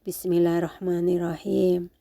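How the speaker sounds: usual speaking voice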